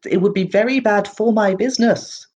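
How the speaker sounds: a nervous little merchant protecting his business; halfling shopkeeper anxiously refusing an ambush in his shop; agitated shopkeeper. Acted.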